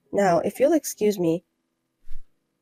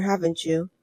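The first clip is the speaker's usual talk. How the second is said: ordinary talk, nothing marked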